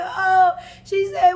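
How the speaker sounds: high, loud mock wail; mock crying. Co-host acts out crying scene from the film, mock wailing. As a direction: imitate a tearful movie outburst. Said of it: "when she cried".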